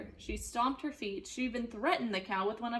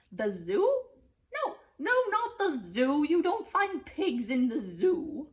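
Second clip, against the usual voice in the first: loud, high mocking witch; scoffing witch; 5 semitones higher, 6 dB louder than usual. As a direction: dismiss it with scorn. Witch rejects idea.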